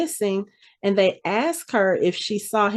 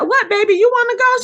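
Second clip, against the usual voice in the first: a lightly voiced syrupy mama, pitched higher; mock sweet mother; 4 dB louder than usual. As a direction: coo it like a falsely sweet mother. Dee voiced, sweetly asking daughter.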